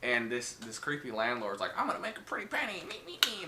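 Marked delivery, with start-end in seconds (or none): 1.8-3.5 greedy landlord. Retold or imitated.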